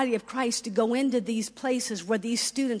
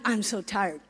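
usual speaking voice

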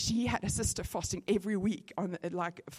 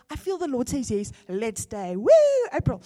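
high, loud, delighted retelling; excited announcement; 4 semitones higher, 9 dB louder than usual. Retold or imitated. Speaker retelling telling friends she'll stay, excited. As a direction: announce the good news gleefully. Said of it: "So I go".

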